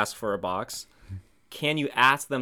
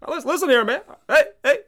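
much higher, louder Obama voice arguing with a heckler; calm down a heckler as Obama, pitched way up; Obama impression; 8 dB louder than usual. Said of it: "I have an Obama".

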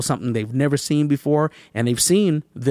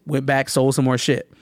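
ordinary delivery

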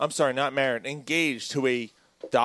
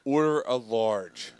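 slow retold line; 6 semitones lower, 5 dB quieter, slower than usual. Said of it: "She goes".

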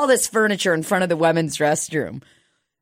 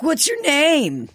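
slow, high friendly clerk voice; drawn-out welcoming question; mock salesman friendliness; clerk asking; 8 semitones higher, slower than usual. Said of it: "they were like".

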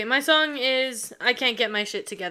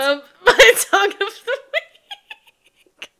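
slow, high, broken by laughter; laughing hard; 11 semitones higher, 8 dB louder, much slower than usual. Real, not acted. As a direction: barely get it out laughing. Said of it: "Why are you laughing so hard?".

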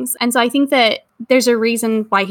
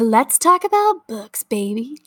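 sexy voice. Pretend. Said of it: "say it in a sexy voice".

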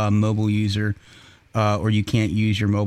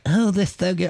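mock gushing wife; 9 semitones higher than usual. And she was like.

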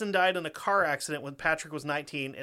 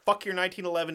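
normal talking voice

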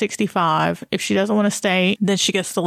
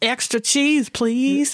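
mock order; 5 semitones higher, slower than usual.

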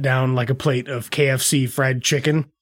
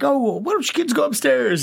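high nervous parent; 11 semitones higher than usual. Retold or imitated.

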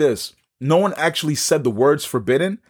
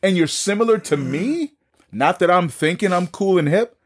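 incredulous; 6 semitones higher than usual. Retold or imitated. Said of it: "So I'm like".

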